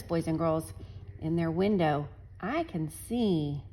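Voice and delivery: ordinary delivery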